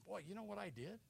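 soft, sheepish confession; acted guilty admission under the breath; ashamed. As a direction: shrink back quietly, too guilty to pray. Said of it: "we're kind of ashamed".

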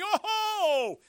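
high, loud hammed-up howl; mock screaming. False prophets leaping on the altar, mock scream. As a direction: scream it out like a frenzied worshipper. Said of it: "They're screaming".

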